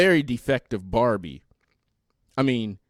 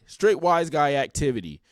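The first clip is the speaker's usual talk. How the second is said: ordinary talk, nothing marked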